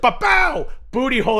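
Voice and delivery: high, loud blurted yell; mock shout. Guy in the car yelling a sudden high loud shout. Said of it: "guys, like".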